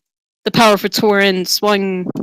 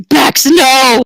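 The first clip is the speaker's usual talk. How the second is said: exclaimed; 5 semitones higher, 5 dB louder than usual. Acted. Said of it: "Tonda exclaimed".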